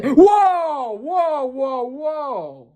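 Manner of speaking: high, rising disbelief; real shock at a reveal; stunned whoa. Real, not acted. Fan realising the twins are Shanks's, shocked whoas.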